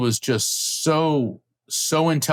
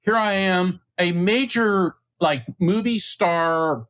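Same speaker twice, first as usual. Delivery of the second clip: excited; 7 semitones higher than usual. Real, not acted. Nervous recollection of pressure.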